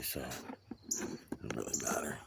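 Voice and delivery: slow whispered aside; hushed, slow whisper to himself; real whisper. Real, not acted. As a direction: whisper so you don't carry. Whispering outdoors while watching.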